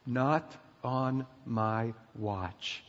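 very slow, word by word, low; solemn pledge. Real, not acted. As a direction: space out each word as a firm vow. Solemn pledge echoing the yell.

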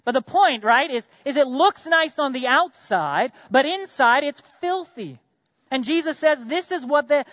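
heated preaching. Acted. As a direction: preach it up high with conviction. Preacher's peak on hypocrisy.

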